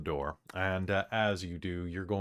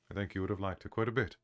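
low, soft, solemn elder; grave low NPC; 5 semitones lower, 9 dB quieter than usual. Acted. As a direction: speak low and heavy like an old sage.

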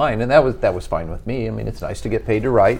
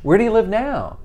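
mock gushing; 8 semitones higher than usual. Mocking people's remarks about Kansas City.

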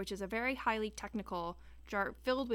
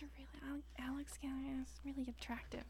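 whisper; 5 semitones higher, 9 dB quieter than usual. Real, not acted. Confiding whisper crush.

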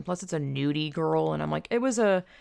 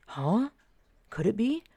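puzzled, rising questions; mock wondering; 5 semitones higher than usual. Storyteller voices her baffled brain, mock wondering. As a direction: lift each question in doubtful wonder. Acts out her confused thoughts.

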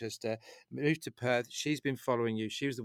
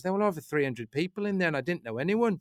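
higher-pitched attempt at a Manchester accent; Kelly's Manchester accent; 6 semitones higher, 4 dB louder than usual. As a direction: retell it as an amazed friend with a Manchester accent. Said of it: "I'm trying to do her accent".

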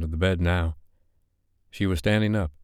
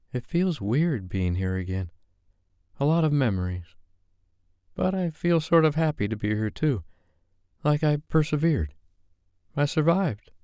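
higher, tentative then proud; Sophie's voice; 8 semitones higher than usual. A narrator's reading. Sophie telling her friend she feels she survived, tentative.

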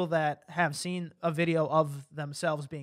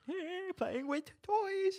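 put-on high slow childish voice; 12 semitones higher, 9 dB quieter, much slower than usual. Playing along as child.

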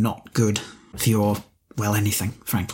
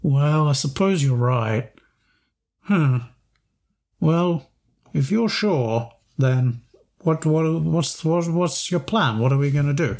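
hesitant Boris voice; 3 semitones higher than usual. Acted. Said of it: "He goes".

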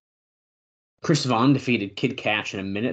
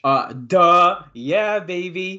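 cocky gloat; 8 semitones higher, 8 dB louder, much slower than usual. Real, not acted. Cocky boast about a pick.

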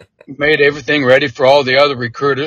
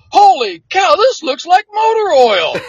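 amazed; 13 semitones higher, 4 dB louder than usual. Retold or imitated. Recruiters shocked at the coffee.

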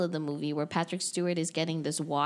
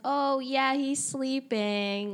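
high, slow, sing-song fake comfort; mock reassurance; 7 semitones higher, much slower than usual. Mocking the heroes' cover story, high slow fake reassurance. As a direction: coo it like an obvious lie.

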